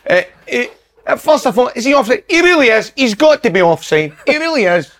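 high, bright commentator patter; a quick pundit McCoist send-up; Ally McCoist impression. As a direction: lift the pitch and gush like the pundit. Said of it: "Does it sound like him?".

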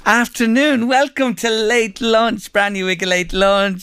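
bright, high, drawn-out welcome; radio host greeting. Real, not acted. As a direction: open the show with a lift. Radio host opening the show.

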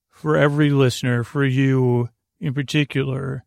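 ordinary delivery

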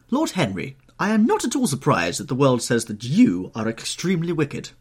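high, loud society-lady reproof; playful scolding. A narrator's reading. Hostess hitting guest with her fan, scolding.

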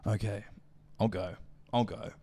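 low, soft, resigned mock retreat; imitated meek exit, pauses between phrases; dejected surrender. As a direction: give in quietly, voicing a rejected man. Voices man being kicked out.

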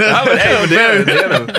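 high, loud laughing. Real, not acted. Cohost cracking up over Peter's accent, high laughing. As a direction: squeal it out through laughter.

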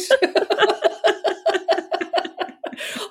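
long, high cackling laugh; helpless real laughter. Real, not acted. Friends share the baby-name joke, long high laugh.

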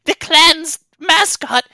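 high, loud, stammering creature voice; small frightened creature lamenting; distraught little kobold. Acted. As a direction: blurt it out in a squeaky wail. Plays the kobold.

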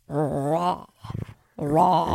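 put-on low, soft, drawn-out grunt of an accent; bad Australian-sounding accent. Pupil ordering steak, low mangled Australian-sounding accent. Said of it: "he sounds like he's speaking Australian".

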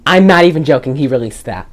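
usual speaking voice

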